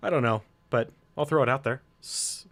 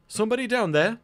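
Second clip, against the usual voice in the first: high, louder call downward; 11 semitones higher, 4 dB louder than usual. Acted. Guard calling down to intruders, a raised call. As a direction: call out to someone below. Character calls down from above.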